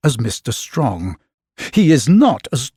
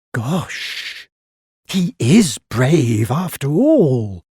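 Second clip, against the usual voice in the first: in awe; much slower than usual. A narrator's reading. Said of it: "she said in awe".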